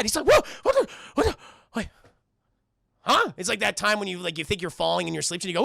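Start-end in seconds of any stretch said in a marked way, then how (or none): 0.3-3.3 high, loud broken gasps; hammed-up jolt awake, confused; startled waking. Max jolts awake from nightmare.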